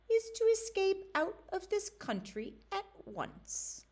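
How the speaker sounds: high, eerie enchanter's daughter; sly high voice of a young witch. Acted. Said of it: "she said".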